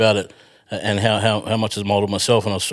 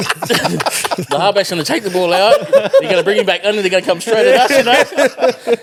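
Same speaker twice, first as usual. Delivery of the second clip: high, laughing retelling, slowing down; can barely get it out for laughing; laughing through story; 9 semitones higher, 4 dB louder than usual. Real, not acted. Laughing while retelling the game.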